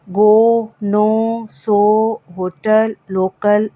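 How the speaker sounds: गो नो सो होटल लोकल with an open long o; open syllable o. Shown as a demonstration. Teacher modelling open-syllable words for students with a long o. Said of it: "open syllable हो तो ओ की sound".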